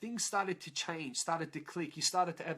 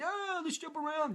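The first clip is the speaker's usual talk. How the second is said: mock excitement; 11 semitones higher than usual. Sceptical attendee mimics the jumping crowd, mock excitement. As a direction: mimic a giddy, happy crowd in a high voice.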